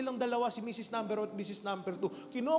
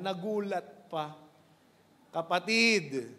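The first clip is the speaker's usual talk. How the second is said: ordinary talk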